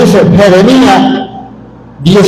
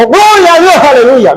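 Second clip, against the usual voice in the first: joyful praise cry; 7 semitones higher than usual. Retold or imitated. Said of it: "y dijo".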